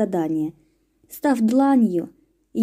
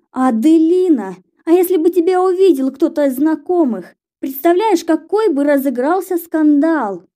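alarmed scolding; 6 semitones higher, 7 dB louder than usual. A narrator's reading. Sister scolds about scandal.

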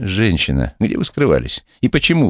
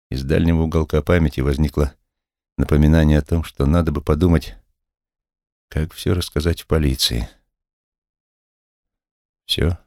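ordinary delivery

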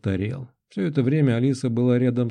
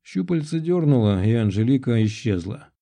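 usual speaking voice

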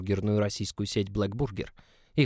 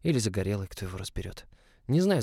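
ordinary delivery, nothing marked

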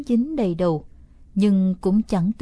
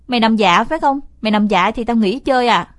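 loud threatening demand; 6 dB louder than usual. Acted. Brother threatens to stop playing with sister, loud threat. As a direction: threaten to quit the game. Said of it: "Nghe tôi dọa nghỉ chơi".

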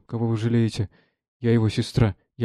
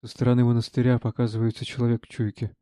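normal talking voice

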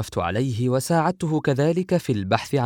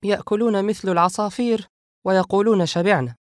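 high, louder exasperated complaint; grumbling; 9 semitones higher, 4 dB louder than usual. A narrator's reading. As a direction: grumble in a high, annoyed woman's voice. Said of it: "عائشة تكمل طعامها متذمرة". (تذمر بصوت نسائي عال)

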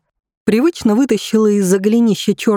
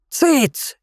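sharp hush; 6 semitones higher than usual. A narrator's reading. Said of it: "перебила её старуха".

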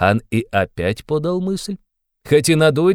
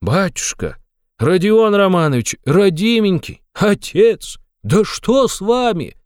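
alarmed pleading; 7 semitones higher, slower than usual. A narrator's reading.

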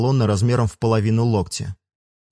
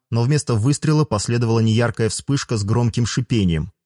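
ordinary delivery

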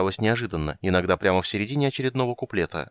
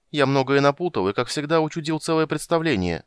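higher-voiced glum confession; understated, rueful little character; mildly dejected; 6 semitones higher than usual. A narrator's reading. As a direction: admit it with a touch of misery. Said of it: "с унылым видом продолжил он".

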